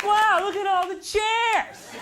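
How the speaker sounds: high, bright sing-song mock cheer; mock chirpy voice. Comic voicing empty chairs that taunt him, mock cheerful. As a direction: sound like the furniture taunting him.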